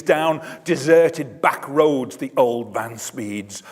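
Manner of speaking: ordinary delivery, nothing marked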